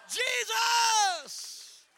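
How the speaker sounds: high, loud, drawn-out cry; shouted Jesus again. Shown as a demonstration. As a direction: call it out with them. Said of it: "Come on, somebody say that".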